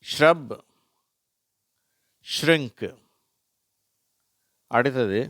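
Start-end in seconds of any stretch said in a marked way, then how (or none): 0.1-2.9 isolated words, pitched up and louder; model word reading. Shown as a demonstration. Teacher giving example words to learners, model pronunciation. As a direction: read out ஷ்ரப், ஷ்ரிங்க் as clear examples. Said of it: "அந்த வார்த்தைகள்".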